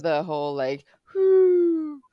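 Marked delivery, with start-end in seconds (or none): 1.2-2.0 high, drawn-out "whoo"; flying whoop. Acted. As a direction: mimic a whoosh up into the air. Imitates someone flying up on wires.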